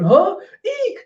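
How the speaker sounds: high, shrill-pitched yelp of fright; startled squeak. Retold or imitated. Gifters watching the beheading, squeaking high in fright. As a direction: squeak like a spooked onlooker. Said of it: "all the gifters going".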